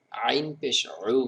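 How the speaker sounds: আইন পেশ উ squeezed in the throat, slow; উ pressed out from the throat. Shown as a demonstration. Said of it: "গলা চেপে বের হবে". (গলা চেপে আইন পেশ উ)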